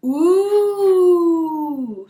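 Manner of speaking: high, loud ooh of wonder; children gaping at the sights; awed ooh. Acted. Little crocodiles see Egypt, loud high ooh. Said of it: "said all the little crocodiles".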